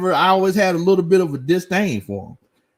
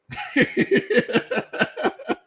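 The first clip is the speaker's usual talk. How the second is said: airy high laugh; 5 dB quieter than usual. Real, not acted. Reacting to chat comment.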